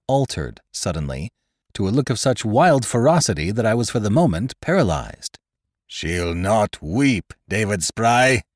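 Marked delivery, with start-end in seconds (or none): 5.9-8.4 a slow, dragged-out bellow, voice changed. A narrator's reading. Winter bellowing at David Spry in the hold, slow savage roar. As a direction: roar it slowly like a half-wit turned savage. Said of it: "he cried out in a bellowing voice".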